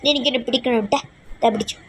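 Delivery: normal talking voice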